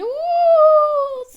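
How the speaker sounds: long, loud, very high howl of crying; howling wail. A narrator's reading. Anna-Liza bawls after the slap, high howling wail. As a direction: bawl like a slapped child. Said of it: "заревіла Анна-Ліза".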